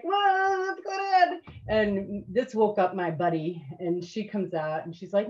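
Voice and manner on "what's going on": high, drawn-out startled question; startled bystanders. Retold or imitated. Fellow soldiers to yelling sleepwalker, startled. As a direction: imitate woken soldiers, high and slow. Imitating startled camp mates.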